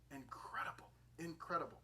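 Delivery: whispered in wonder; awed whisper. Real, not acted. Preacher marvelling at the mother's humility, whispered. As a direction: breathe it out amazed.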